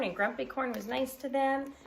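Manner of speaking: ordinary delivery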